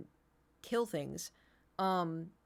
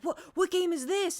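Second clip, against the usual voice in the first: high, loud, stumbling excitement; excited younger brother; 11 semitones higher, 5 dB louder than usual. Retold or imitated. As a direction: mimic a brother bursting in, thrilled. Brother runs in excited, acted.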